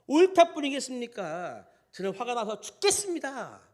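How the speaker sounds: high, loud indignant retort; acted anger. Jonah answering God about the dead vine, acted angry protest. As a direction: answer back hotly, as if wronged. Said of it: "이렇게 화를 내는 것이".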